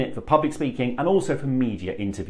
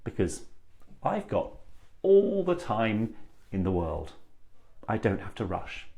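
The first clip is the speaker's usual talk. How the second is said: all the time in the world; calm, assured chunking; confident unhurried; 4 semitones lower, 7 dB quieter than usual. Shown as a demonstration. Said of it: "they make you sound really confident".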